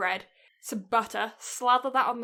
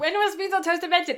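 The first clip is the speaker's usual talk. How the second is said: higher and louder, announcing the question; announcing the search; 7 semitones higher, 5 dB louder than usual. Real, not acted. Reading the Google search to her friend, loud announcement. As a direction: call out the search like a headline. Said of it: "It actually comes up on Google search".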